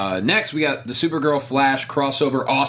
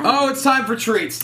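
excited; 10 semitones higher, slower than usual. Acted. Announcing treats gleefully.

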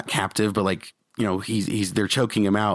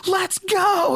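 high-pitched imitation of a fan's cheer; retold excited exclamation. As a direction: burst out like a thrilled moviegoer. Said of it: "and he just exclaims".

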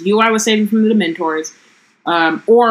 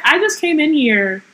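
much higher, sassy Ginny voice; 8 semitones higher than usual. Retold or imitated. Podcaster retelling Ginny snapping back at Harry, high and sassy. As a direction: brush him off like a fed-up teen. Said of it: "She's like".

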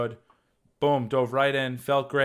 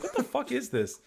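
high, hushed what-the-hell; baffled under your breath at the odd request; stunned disbelief; 11 semitones higher, 4 dB quieter than usual. Retold or imitated. Actor asked to juggle, hushed high disbelief. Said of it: "I'm like".

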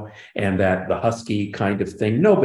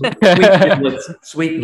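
higher, louder, slowed "sweet niblets" in a character twang; catchphrase voice; 8 semitones higher, 9 dB louder, slower than usual. Retold or imitated. Creator showing off the sitcom catchphrase in a character voice. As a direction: belt the catchphrase like the show does.

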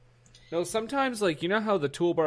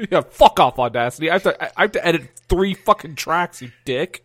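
loud, raised joking outburst; mock angry rant; 3 semitones higher, 6 dB louder than usual. Podcaster telling off Audacity, mock angry rant. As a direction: cuss it out in mock outrage.

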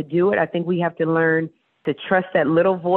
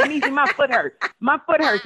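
higher, whining about a sore foot; mock whiny complaint; 8 semitones higher than usual. Asking friend Niecy for help, higher mock whine. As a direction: moan to a friend, playfully pitiful. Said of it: "You just say".

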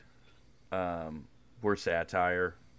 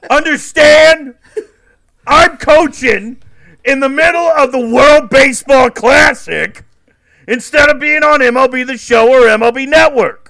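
Mark DeRosa impression; 14 semitones higher, 12 dB louder than usual. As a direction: bellow it like a cartoon baseball legend. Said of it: "Because I was talking like Mark DeRosa".

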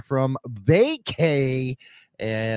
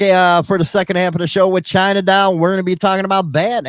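louder, higher, fast show plug; announcer sign-off; 7 semitones higher, 8 dB louder, faster than usual. Real, not acted. Host promising listeners the second half, hyped. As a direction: sell the upcoming segment. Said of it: "We'll be right back".

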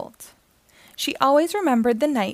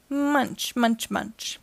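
munching sound; much slower than usual. Acted. Strange sound from the bushes.

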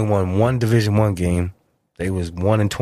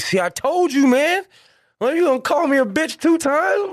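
scolding. Retold or imitated. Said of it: "I'm like".

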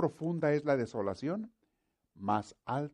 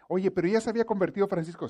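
quick, louder mock objection from an audience member; imagined objector; 8 semitones higher, 8 dB louder, faster than usual. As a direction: toss in a skeptic's interruption.

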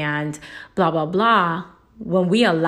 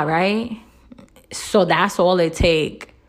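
usual speaking voice

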